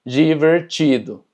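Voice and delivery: divertido slowly as "jiverchido"; slow model of divertido, di as "ji", ti as "chi"; DI como ji. Shown as a demonstration. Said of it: "que se pronuncia chi". (divertido lento, dicho «jiverchido»)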